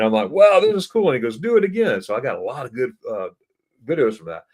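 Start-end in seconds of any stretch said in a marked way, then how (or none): 0.3-1.1 high, loud thrilled exclamation; excited wow. Retold or imitated. Retells his excited reaction. 1.4-2.0 eager urging. Retold or imitated. Quotes friend urging him on.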